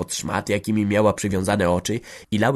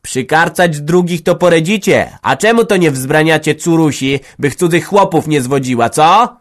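cutting attack; 7 semitones higher, 9 dB louder than usual. Acted. Village head's wife accusing the mother, loud and angry. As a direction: strike back hard. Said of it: "ciepnęła ją na odlew".

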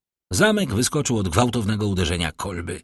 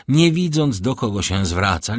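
usual speaking voice

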